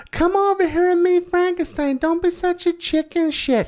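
put-on very high girly voice, slowing on the insult; mock little girl. Phoebe daring the boys, hammed-up high little girl. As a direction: tease like a bratty little girl. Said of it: "even Phoebe is like".

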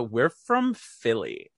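drawn-out imitation of a Philly accent. Women on a Disney bus answering a stranger, slow Philly accent. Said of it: "And they go".

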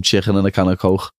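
"chicken" with a throaty ch and "Coke" ending in a rasping kh; Scouse chicken and Coke. Shown as a demonstration. Scouser shows the phrase fans request: Scouse accent. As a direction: give them the Liverpool "Coke" they ask for. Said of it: "everyone always gets us to say".

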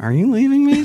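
squeaky high mock plea; high pleading imitation. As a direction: pitch way up, plead like a hurt friend. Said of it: "He's like".

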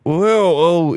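mock the old man's voice break; imitating grandpa's voice. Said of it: "his voice kinda goes like".

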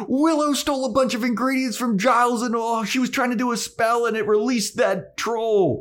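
high, hurried tattling; high-pitched panicky confession; Anya, frantic. Acted. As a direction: blurt it all out to snitch on Willow. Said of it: "Anya's like".

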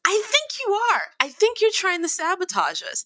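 high, insistent accusing voice; re-enacted pointed suspicion; retold accusation. Pressing the accusation against sound guy.

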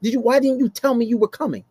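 ordinary talk, nothing marked

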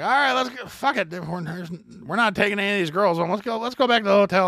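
rally the group to go; drunk urging. Retold or imitated. Drunk teen urging friends back to the hotel, high and loud. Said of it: "and it's like".

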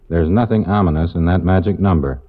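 usual speaking voice

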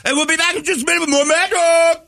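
very high, loud, piercing show sign-off; piercing radio host screech; Mad Dog impression. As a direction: throw to the break screaming like a sports radio host. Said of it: "at top of his lungs, Mad Dogging away".

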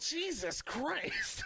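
high, slow, drawn-out mock dismay; appalled crowd. Imitating a stunned crowd, high and slow. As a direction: stretch it out like a horrified fan. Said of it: "They were like".

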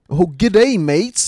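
lightly voiced American Aussie; American Australian accent. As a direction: greet like an American faking Aussie. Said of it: "can you do an American Australian accent".

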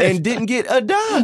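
very high, slow, drawn-out punchline; mock outrage. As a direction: land the scandal with theatrical incredulity. Punchline of mock-shocking story.

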